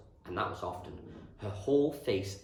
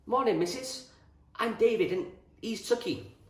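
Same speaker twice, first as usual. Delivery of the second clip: put-on high, louder boy's voice; young boy greeting; 13 semitones higher, 5 dB louder than usual. A narrator's reading. David greeting Missus Reynolds in the kitchen, piping boy's voice. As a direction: pipe up to introduce yourselves.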